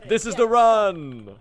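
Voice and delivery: drawn out, loud and high, thrilled; excited declaration. Real, not acted. Streamer to chat, slow, loud and thrilled. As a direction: savour it like the dream run finally arrived. Streamer realizes run is going great.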